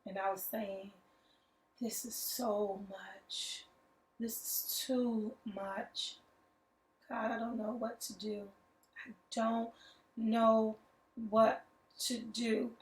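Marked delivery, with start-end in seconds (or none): 1.8-12.8 quiet, slow, worn-out crying prayer; tearful plea to God. Retold or imitated. Alone in the bathroom, crying out to God, quiet and slow. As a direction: whisper a broken plea, barely holding together. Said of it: "I was just crying to God".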